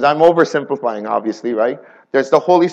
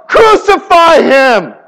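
slow, louder, higher mock crowd cry; crowd yelling; 7 semitones higher, 6 dB louder, slower than usual. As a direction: call it out slowly like the mob. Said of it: "they yelled".

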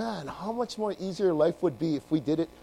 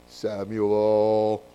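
low, drawn-out booming God calling a name; 10 semitones lower than usual. Acted. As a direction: intone the name slowly and deep. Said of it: "That's my God voice".

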